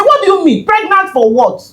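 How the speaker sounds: high, incredulous retort; stunned high-pitched protest to the nurse; shocked disbelief. Retold or imitated. Disbelief at pregnancy news.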